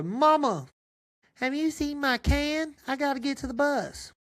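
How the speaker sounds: put-on high whiny boy asking his mom; a young son calling up to mama; kid voice. Son asking his mom for his can, high boyish voice. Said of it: "Danny boy".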